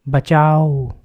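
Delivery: the last desperate scream before silence. A narrator's reading. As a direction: scream it with everything left. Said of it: "आखिरी चीख शाम की".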